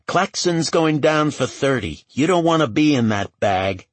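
brash voice building in pitch and force; streetwise woman warning. A narrator's reading. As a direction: lean in with a loud, emphatic warning. Ray's character voice lectures Oakes.

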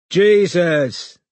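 high, loud dazed exclamation; bleary stoner. A narrator's reading. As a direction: blurt it out, half-stoned. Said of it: "he said blearily".

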